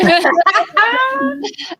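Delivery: squealing giggle, half crying; high embarrassed laugh. Real, not acted. Embarrassed after bad accent.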